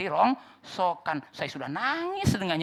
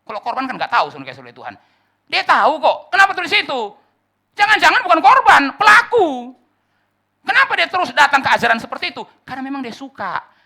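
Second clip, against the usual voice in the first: loud, high, accusing preaching; full-force preaching; 9 semitones higher, 14 dB louder than usual. Acted. Preacher challenges those who stay in false churches, thundering. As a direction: hammer the point from the pulpit.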